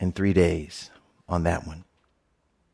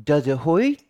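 put-on little girl voice; 4 semitones higher, 3 dB louder than usual. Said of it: "the little girl says".

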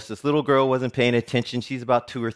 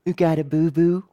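higher, lightly voiced toddler asking; toddler's question in a raised little voice; little girl voice; 6 semitones higher, 3 dB louder than usual. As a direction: play a curious toddler, pitched up. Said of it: "I hear a little voice go".

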